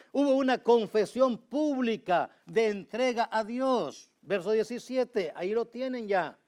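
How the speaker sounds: loud, high, fervent preaching; full-force preaching. Acted. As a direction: drive it home from the pulpit, loud and high.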